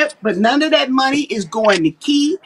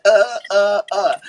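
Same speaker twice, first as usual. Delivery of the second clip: stuck on repeated uhs, lower pitched; imitating hesitant liar; 5 semitones lower than usual. Mocking people who stall when they talk, imitated hesitation. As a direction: mimic a liar's stalling filler sounds. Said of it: "when people start doing all that".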